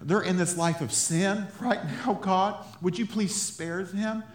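high, urgent pleading with God; impassioned plea for mercy; fervent prayer. Acted. Models a fervent intercessory prayer.

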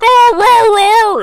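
high loud mock yell; mock roar. Imitating Candido yelling at the women, screeching. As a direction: screech it like an overwrought wrestler. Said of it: "Candido's like".

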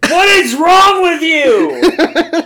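high, loud, slow hammed-up yell; mock yell at Rouge. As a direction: bellow it like a cartoon hothead. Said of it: "yelled Knuckles at Rouge".

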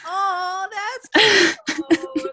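squealing, high and loud; gushing over something adorable; overwhelmed by cuteness. Real, not acted. Reacting to cute superhero name.

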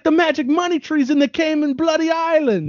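put-on high, emphatic Jamaican accent; a light mock Jamaican accent. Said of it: "I'm doing a Jamaican accent".

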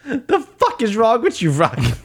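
high-pitched trainer's outburst; hammed-up high disbelief at a hopeless fighter; mock exasperation.

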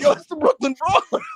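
light up at a legend's voice; excited recognition. Retold or imitated. Host recognizing a wrestling legend on stream, excited.

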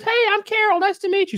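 very high put-on greeting voice; Carol. Introducing oneself to the presidents, high put-on greeting. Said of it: "a quick conversation with Carol".

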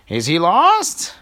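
put-on high, loud, slow old lady question. Old lady fussing at the princesses, high and slow. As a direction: stretch it out, fussy and concerned. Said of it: "the old lady said".

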